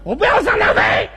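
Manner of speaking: high-pitched holler, far above talking voice; comic shouted cry. Acted. Performer quoting himself protesting a trip, high shout. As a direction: belt it out high like a street shout. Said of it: "就这嗓子".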